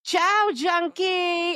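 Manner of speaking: high, long-drawn farewell call; stretched-out, sing-out mock yell; retold yell. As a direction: imitate a girl yelling goodbye from a bus. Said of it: "and she yells out".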